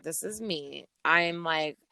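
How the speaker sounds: usual speaking voice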